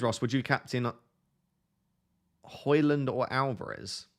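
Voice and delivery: ordinary talk, nothing marked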